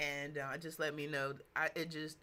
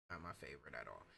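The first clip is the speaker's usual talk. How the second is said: low, much softer grumbled repeat; Not my favorite at all, dropped low and soft; muttered repeat; 13 dB quieter, much faster than usual. Real, not acted. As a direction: mutter it under your breath, lower. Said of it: "the arms thing is not my favorite".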